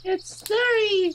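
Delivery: squeaky. Acted. Teasing about the cozy room, a high squeak.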